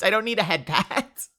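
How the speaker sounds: emphatic mock complaint; mock protest. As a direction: push back in playful indignation. Protesting unwanted praise.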